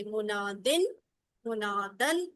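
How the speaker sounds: normal talking voice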